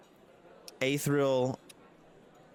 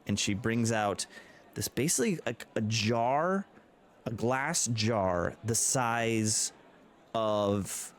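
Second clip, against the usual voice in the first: usual speaking voice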